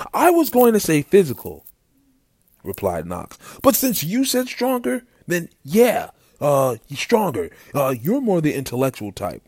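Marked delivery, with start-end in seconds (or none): 0.1-1.6 high, loud protest; flustered correction. A narrator's reading. Knox correcting Arthur's guess, flustered high voice. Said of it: "Arthur cut in". 3.6-9.4 high, loud and hesitant; flustered explaining. A narrator's reading. Knox telling Arthur his brother is stronger, flustered high voice.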